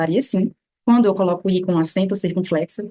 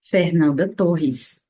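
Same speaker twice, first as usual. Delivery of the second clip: the name in Brazilian Portuguese, slowly; Brazilian pronunciation; 2 semitones lower, much slower than usual. Shown as a demonstration. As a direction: Brazilian accent, R's as H in FERNANDA TORRES. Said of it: "como uma boa brasileira".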